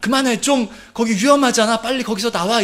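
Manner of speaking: high, urgent yelling; strong shout. Acted. Parent shouting at a child to come away from danger. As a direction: order the child out of danger right now. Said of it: "이렇게 강하게 말을 하지 않습니까".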